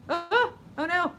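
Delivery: high, loud startled oh no; sudden alarm. Real, not acted. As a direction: caught off guard by the phone. Phone suddenly acts up mid-reading.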